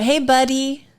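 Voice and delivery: mock-chummy, talking down to a pal; condescending. Keeping a male friend at arm's length, condescending. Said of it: "So condescending".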